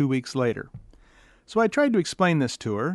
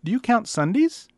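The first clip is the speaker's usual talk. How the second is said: naive caller question; 8 semitones higher than usual. Retold or imitated. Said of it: "she said to me".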